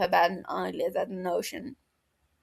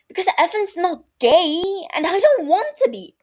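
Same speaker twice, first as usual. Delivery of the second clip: louder, higher, half-laughing in distress; anguished outburst; 10 semitones higher, 8 dB louder than usual. Acted. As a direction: blurt it out, frustrated and cracking. Outburst after an animalistic growl.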